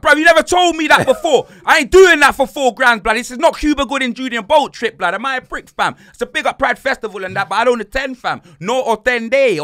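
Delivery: loud, high, outraged refusal; indignant protest. Retold or imitated. Tourist refusing the plug's couple scheme, loudly indignant re-enactment. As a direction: re-enact an affronted, incredulous objection.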